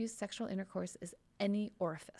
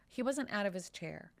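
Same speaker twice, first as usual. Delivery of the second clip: normal talking voice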